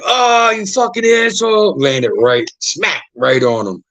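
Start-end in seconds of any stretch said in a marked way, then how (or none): 0.0-1.8 high pained complaint. Retold or imitated. Freddie landed on in the closet, high pained. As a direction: groan it as someone lands on you. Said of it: "he's like".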